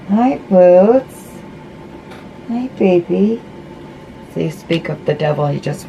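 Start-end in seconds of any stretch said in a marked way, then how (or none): 0.1-3.4 higher, sing-song voice for the cat; sweet talk to a pet cat. Real, not acted. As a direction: coo a hello to your cat. Greeting the cat walking in.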